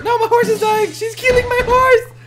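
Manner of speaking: high, loud cry of alarm; horrified, high-pitched shouting; panicked yelling. Real, not acted. Horse under attack in game.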